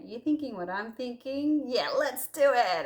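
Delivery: eager, conspiratorial invitation; playful mock-excited plotting; excited friends. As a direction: pitch it up like a mischievous dare. Friends deciding to go out.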